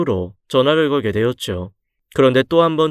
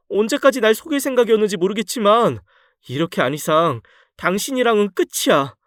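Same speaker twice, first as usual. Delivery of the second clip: indignant wife; 6 semitones higher than usual. Acted. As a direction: pitch up, outraged and disbelieving. Wife ends the marriage.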